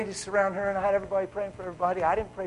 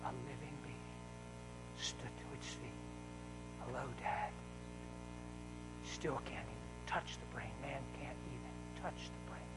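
much softer, lower awed preaching; hushed preaching; 5 semitones lower, 17 dB quieter than usual. Acted. Preacher telling the congregation of creation in an awed hush. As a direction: drop to a reverent hush.

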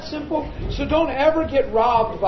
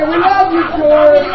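loud, higher rallying call; playful calling out; 5 semitones higher, 9 dB louder, slower than usual. Real, not acted. Preacher getting everyone to reassure George, loud playful call. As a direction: lead the room in a teasing cheer. Leading room in teasing George.

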